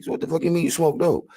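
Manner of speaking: high, disbelieving street challenge; incredulous challenge. Retold or imitated. Telling the woman he doesn't believe her, high incredulous voice. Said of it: "That's what I told her".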